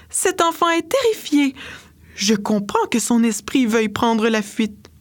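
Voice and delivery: put-on the dolphin fairy fretting over the frightened child. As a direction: worry over the child tenderly. Dolphin fairy voiced high.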